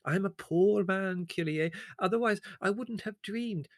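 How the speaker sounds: high, slow, wheedling complaint; whining villager. Acted. The servile peasant complains to Leslie: high wheedling whine. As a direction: plead your loss with fawning humility.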